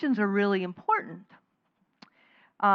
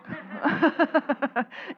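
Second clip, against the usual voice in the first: breathy laugh, trailing down; 6 semitones higher than usual. Real, not acted. Speaker laughing after the reworded question, real laugh. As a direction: laugh at your own punchline.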